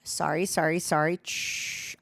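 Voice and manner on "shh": long hushed "shh"; shushing. Real, not acted. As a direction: let out one drawn-out shush. Shushing the sound mid-task.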